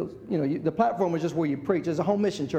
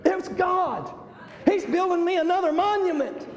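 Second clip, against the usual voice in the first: high joyful shout of yay; inner yay; 10 semitones higher, slower than usual. Retold or imitated. Preacher voicing his inner cheer, excited shout. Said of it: "inside I was going".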